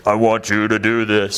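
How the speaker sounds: a slow, mock-grand voice of God, lightly voiced; booming divine voice. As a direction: play God as some magnificent power, only a little. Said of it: "some crazy ... some magnificent power".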